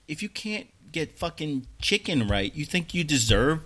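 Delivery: slow, pointed, rising to loud; retold comeback. Customer firing back at the KFC staff, slow and rising. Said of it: "But I said".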